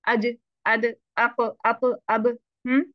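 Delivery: अज, अद, अप, अब with an echoing bounce; bounced qalqala. Shown as a demonstration. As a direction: let each stopped letter bounce out. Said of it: "उनको हमने bounce कर दिया". (अज अद अप अब क़लक़ला के साथ उछाल कर)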